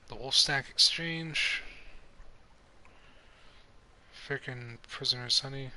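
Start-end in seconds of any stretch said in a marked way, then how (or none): none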